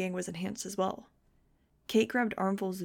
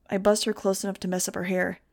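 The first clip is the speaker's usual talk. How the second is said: usual speaking voice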